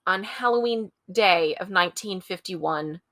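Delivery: usual speaking voice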